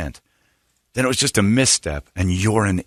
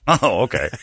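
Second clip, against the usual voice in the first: ordinary delivery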